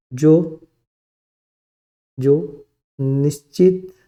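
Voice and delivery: drawn-out words, long gaps between; dictation. Real, not acted. Teacher dictating definition of shabd, slow dictation. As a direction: dictate very slowly, word by word.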